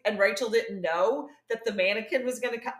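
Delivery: ordinary talk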